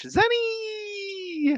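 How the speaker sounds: high, delighted shout of Zenny; excited call. Real, not acted. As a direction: call out the name with joy. Greeting a viewer excitedly.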